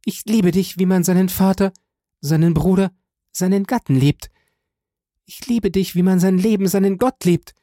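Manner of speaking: high, passionate declaration of love; fervent, high voice, phrase upon phrase; rapturous young woman. A narrator's reading. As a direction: pour out adoration, pitched high.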